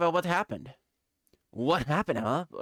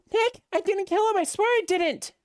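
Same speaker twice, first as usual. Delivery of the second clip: pleading; 11 semitones higher, 3 dB louder than usual. Acted. Pleading innocence while arrested.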